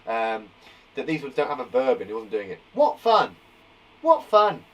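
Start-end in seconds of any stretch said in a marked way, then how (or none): 2.7-4.6 loud, punchy exclamation. Shown as a demonstration. Teacher showing an exclamation sentence to the class, exclaiming. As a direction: exclaim it with force. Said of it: "it's got an exclamation point".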